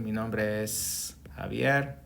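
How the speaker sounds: slow "Mi nombre es Javier", the S of "es" clearly voiced; careful Spanish model for beginners; sounding the S. Shown as a demonstration. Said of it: "you need to pronounce the S".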